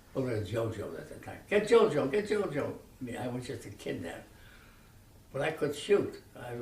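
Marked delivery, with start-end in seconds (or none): 1.5-2.7 1 father yelling. Retold or imitated. Father calls for son urgently.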